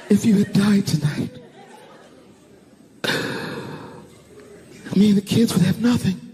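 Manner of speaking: high, tearful voice of a wife; imitated crying. Frightened wife to husband about the kids, imitated crying. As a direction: squeeze the words out through mock sobs. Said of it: "And she's crying".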